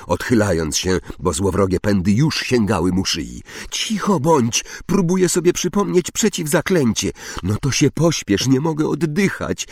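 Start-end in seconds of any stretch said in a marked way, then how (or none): 3.7-7.1 high, flustered retort; tense, irritated exclamation; snapping back. A narrator's reading. Acted quarrel while trapped. 7.4-9.7 high, strained urging; narrator's light version of panting; gasped plea. A narrator's reading. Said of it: "wysapał Harry".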